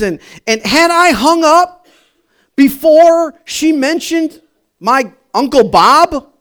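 loud, high-pitched disbelief; incredulous exclaiming. Real, not acted. Preacher tells of a wrong-number conversation, loudly incredulous.

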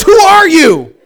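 very high, loud mock panic; alarmed shout. Woman realizes she is talking to a stranger, alarmed shout. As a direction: shriek the question in comic horror. Retold climax, caller demands identity.

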